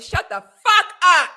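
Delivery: slow, loud, high snap; furious retort. Real, not acted. Vlogger telling the women off, slow loud fury. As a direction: bark it out slowly.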